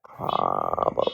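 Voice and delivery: whispered probably. Real, not acted. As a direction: breathe it out as a whisper. Echoing agreement.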